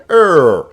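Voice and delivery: "er" starting high and loud, pitch falling; loud demonstration "er" gliding down from high. Shown as a demonstration. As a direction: drop the pitch through a long, loud "er". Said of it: "the grave as a falling".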